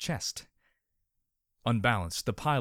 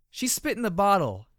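whined; 11 semitones higher, 7 dB louder than usual. Acted. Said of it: "Adagio whined".

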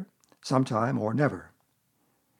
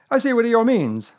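high, loud, self-important bird; pitched-up character voice, butting in; Owl; 10 semitones higher, 8 dB louder than usual. A narrator's reading. Owl offering Eeyore an opinion, high character voice.

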